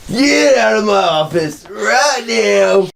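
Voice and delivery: high, slow yelling boss; gravelly old tycoon. Pretend. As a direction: bark the order like a furious boss. Boss yells from off-screen.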